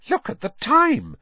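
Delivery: abrupt high alarm at the hour; sudden realisation. A narrator's reading. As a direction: startle as you notice the clock. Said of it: "Missus Weasley said suddenly".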